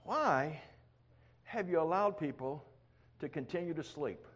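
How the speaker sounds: soft, hushed question to the Lord; quiet prayer. Retold or imitated. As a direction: ask softly, almost a whisper. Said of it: "So I asked the Lord".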